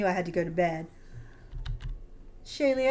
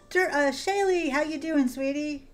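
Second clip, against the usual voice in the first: high happy greeting; 7 semitones higher than usual. Real, not acted. Greeting a returning viewer.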